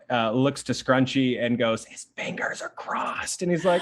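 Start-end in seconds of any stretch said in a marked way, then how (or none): 1.8-3.4 hushed stage whisper; whispered aside. Acted. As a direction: whisper it like a sly secret. Game master whispers as Hat Boy.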